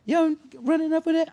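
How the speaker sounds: imitating Tip. Tip asking about the line, high loud imitation. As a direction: toss it off like Tip in the booth. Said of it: "I like to imitate people".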